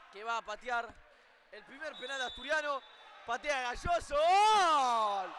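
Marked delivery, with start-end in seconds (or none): 4.2-5.3 loud, high goal shout; breakneck goal commentary. Real, not acted. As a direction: belt out the goal call. Goal called in shootout.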